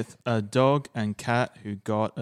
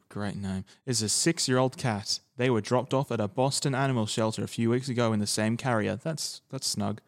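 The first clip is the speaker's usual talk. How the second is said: normal talking voice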